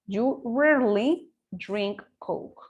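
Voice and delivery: slow, careful example sentence; slow teacher reading. Shown as a demonstration. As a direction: read it out slowly for the class. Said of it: "reads example sentence".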